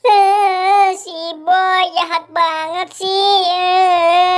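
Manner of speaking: high, loud pretend bawling; mock crying. Po bawling that Boy is so mean, mock crying. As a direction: wail like a pranked cartoon child. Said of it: "Jangan nangis".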